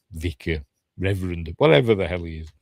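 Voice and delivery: ordinary delivery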